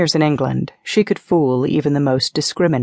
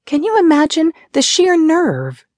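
high-pitched, indignant exclamation; outraged; 3 dB louder than usual. A narrator's reading. Ariana to her friend about her father's letter, outraged. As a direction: exclaim in affronted disbelief.

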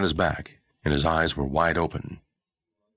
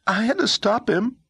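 put-on high, panicky boy's voice; a scared boy blurting it out; panicked boy; 4 dB louder than usual.

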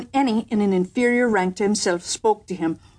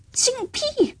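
sudden squeak of alarm, much higher; high cry. A narrator's reading. Lord in waiting crying out at the threat, high yelp. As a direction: yelp it out in a panic. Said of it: "cried the lord in waiting".

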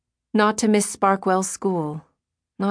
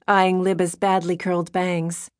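plain reading voice

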